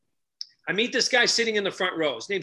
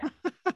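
laugh; 12 semitones higher, 4 dB quieter than usual. Real, not acted. Laugh after joke.

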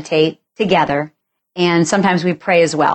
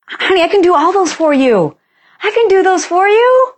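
much higher, keen rescuer voice; eager husband; 12 semitones higher than usual. Retold or imitated. Husband offering to take over her tasks, eager and much higher. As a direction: play the husband rushing to help.

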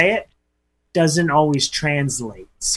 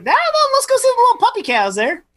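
much higher, fast cooing voice; baby talk; faster than usual. Acted. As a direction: coo like talking to a toddler about cute animals. Co-host coos about puppy cows.

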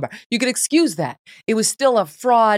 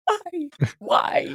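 high, helpless laughing plea; 11 semitones higher, 3 dB quieter than usual. Real, not acted. Host helpless at the absurd clip, laughing. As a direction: squeak it out through laughter.

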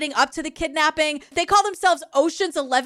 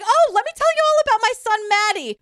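chatty mom; 7 semitones higher, faster than usual. Acted. Mother voiced bragging about son.

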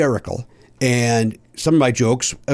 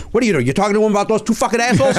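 put-on high, fast, scandalized old-timer voice; imitating Norm Crosby; 9 semitones higher, much faster than usual. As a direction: fire it off like an outraged old comic. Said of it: "Norm Crosby goes".

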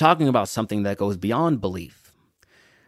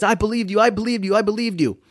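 high, eager crowd chant; excited crowd; 9 semitones higher, faster than usual. Retold or imitated. Crowd shouting back to the tightrope walker, high excited chant. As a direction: cheer it like the whole street believed.

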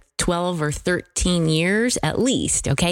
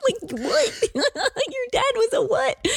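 laughing disbelief; slower than usual. Real, not acted. Excited disbelief at truck dad.

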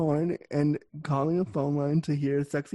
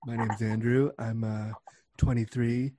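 lowered, softened seductive persona; 4 semitones lower, 4 dB quieter, slower than usual. Retold or imitated. Caller introduces himself on the chat line, in a low mock-Batman voice. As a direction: introduce yourself in a put-on deep voice.

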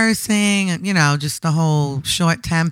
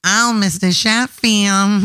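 slow nasal Nanny impression; slow, lightly nasal Fran Drescher impression; Fran Drescher's nanny; slower than usual. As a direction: do the Nanny calling Mr. Sheffield. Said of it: "I could do the nanny".

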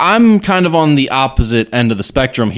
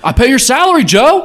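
mock heckler; 10 semitones higher than usual. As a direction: mimic an entitled fan yelling at the player. Mocking a booing fan.

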